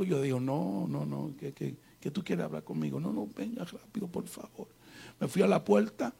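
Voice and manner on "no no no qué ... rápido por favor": softly muttered, reluctant reply; acted hushed unwillingness; wary reluctance. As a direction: say it under your breath, wanting it over quickly. Retells his wary reluctant reply.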